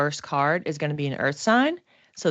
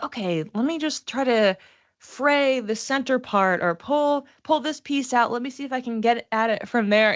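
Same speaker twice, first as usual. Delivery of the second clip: higher, fussing voice of someone picking at a knot; fiddly attempt voiced; 6 semitones higher than usual. Students trying tweezers on the knot, higher fussy voice. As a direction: act out the fiddly effort, pitched up.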